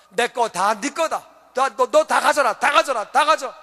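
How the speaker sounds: anxious father's pleading insistence; acted urgency. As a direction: press it on them, repeating with growing urgency. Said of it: "마음이 너무 급해서".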